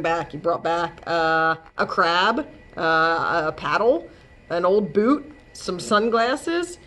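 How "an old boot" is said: ordinary delivery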